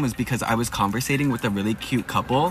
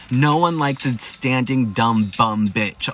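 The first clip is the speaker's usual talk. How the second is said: usual speaking voice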